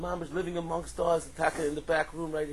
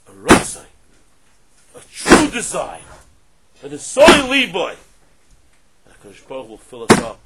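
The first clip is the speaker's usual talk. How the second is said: preaching at full force; 7 semitones higher, 17 dB louder than usual. Acted. Rabbi urging everyone in this room to greatness, shouting fervently. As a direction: rouse the whole room to desire.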